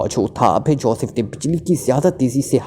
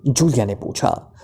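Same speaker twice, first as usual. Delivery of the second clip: usual speaking voice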